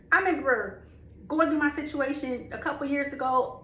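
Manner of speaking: ordinary talk, nothing marked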